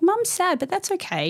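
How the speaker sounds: sing-song comfort to her little daughter; gentle toddler talk. Retold or imitated. Mother reassuring her toddler daughter, high gentle voice.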